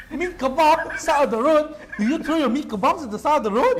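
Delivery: laughing incredulous question; teasing laughter; laughing disbelief. Real, not acted.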